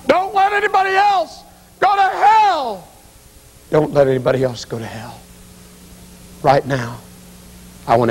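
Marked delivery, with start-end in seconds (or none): 0.1-2.9 slow, very high-pitched retold scream; anguished yell. Mother yells to son after funeral, slow anguished scream. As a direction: wail it out across the mausoleum. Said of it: "she yelled at me".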